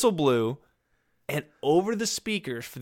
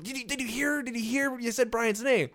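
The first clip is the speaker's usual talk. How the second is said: excited gossip; 7 semitones higher, 6 dB quieter than usual. Retold or imitated. Parents telling his parents.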